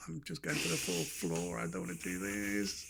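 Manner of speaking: slow, soft, sinking plea; mock-weary protest; retold reluctance. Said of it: "I was like".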